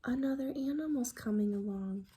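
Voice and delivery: quick hushed whisper. Real, not acted. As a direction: whisper the suspense. Whispered aside to children.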